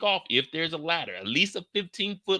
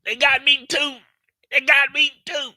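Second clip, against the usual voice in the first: higher, whiny hurt voice; hurt Yin; 12 semitones higher, 5 dB louder than usual. Retold or imitated. Yin telling the crew she is hit, high hurt whine. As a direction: whimper that you got shot too. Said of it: "Yin's like".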